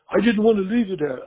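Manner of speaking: high-pitched, lightly acted objection; retold protest. Apartment owner objecting over the phone, mock protest.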